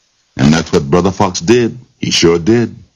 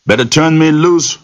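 scared holler; 12 semitones higher than usual. Acted. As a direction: holler it, getting scared now. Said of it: "Rabbit hollered".

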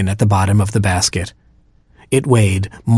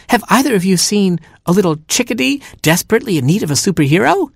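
screamed question; 10 semitones higher, faster than usual. Acted. Little hero searching for the chickadee, mild high-pitched scream. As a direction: cry it out high and fast.

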